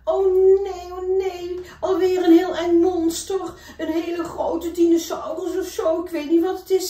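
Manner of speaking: a cub facing a monster; scared little bear. Acted. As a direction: cry out high in fright. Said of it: "zegt Toby".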